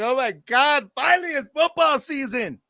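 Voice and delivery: mock the fair-weather fans squealing with delight; mock excited fan. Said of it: "some of y'all were like".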